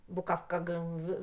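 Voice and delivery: ordinary talk, nothing marked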